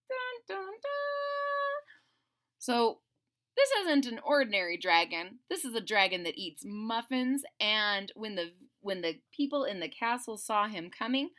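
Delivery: plain reading voice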